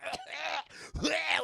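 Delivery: high imitated "aah"; mock disgusted cry. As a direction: cry out as if drenched in blood. Imitated reaction to falling blood.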